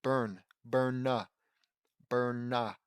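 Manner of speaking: "Burn" with the final n released as "nuh"; careful correction. Shown as a demonstration. Reader drilling a word he keeps saying wrong, careful correction. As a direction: land hard on the n of "Burn", adding a small "nuh". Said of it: "I keep saying Sunburn".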